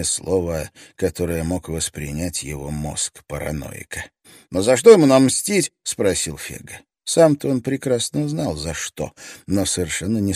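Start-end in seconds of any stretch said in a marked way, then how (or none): 4.5-5.7 panicked question. Acted. Fegga panicking.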